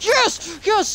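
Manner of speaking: high cheer; triumphant yes. Real, not acted. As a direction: cheer the win. Triumph after kill.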